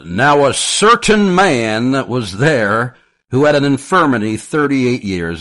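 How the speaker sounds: high, loud, slow Texan sermon impression; Texan preacher accent. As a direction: imitate a thick-accented Texan Baptist preaching loudly. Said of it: "in his very thick, uh, Texan accent".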